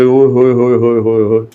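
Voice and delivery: quick, teasing oh-ho-ho of delight; fast playful wonder at a big ride; playful delighted exclamation. Retold or imitated. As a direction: rattle it off like a fond tease.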